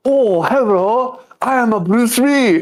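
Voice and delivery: high, very slow put-on voice; mock Bruce Lee act. Retelling Urkel's sitcom gag to his co-host, high and slow. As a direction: ham up a bad fake Bruce Lee. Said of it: "He's all like".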